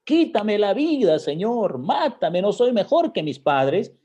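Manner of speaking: high, loud anguished plea; despairing Elijah. Retold or imitated. Elijah begging the Lord to take his life, loud anguished cry. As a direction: cry out like a broken prophet begging to die. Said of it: "el profeta Elías deprimido".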